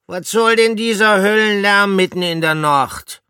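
high, loud grumbling hamster voice; grumpy growl. A narrator's reading. Woken Pomme de Terre grumbles at the noise, loud growl.

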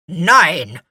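loud, grumpy growl; gruff, displeased character voice. A narrator's reading. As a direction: growl it out curtly. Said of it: "knurrte Gurkenhut".

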